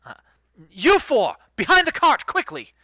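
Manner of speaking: high, loud commands to the crew; rally the crew to move; barked orders. Acted.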